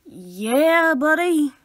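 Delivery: loud, drawn-out hype call; big rowdy shout-out; hyped cheer. Pretend. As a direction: whoop it out loud and rising.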